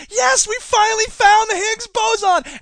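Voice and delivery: high, slow, cheering discovery; scientist's victory cry; triumphant scientist. Acted. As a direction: celebrate like a giddy lab nerd. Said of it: "the scientists are all like".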